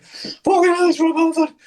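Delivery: overwhelmed fan meeting his idol; starstruck. Retold or imitated. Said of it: "and I was like".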